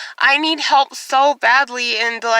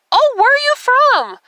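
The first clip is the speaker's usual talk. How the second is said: high-pitched imitation of the doctor's question; mock doctor; 11 semitones higher, 4 dB louder than usual. Doctor bursts in to question patient, high mocking imitation. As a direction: mimic him bursting in, voice pitched way up. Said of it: "basically he asked me, like, opens the door, busts it open".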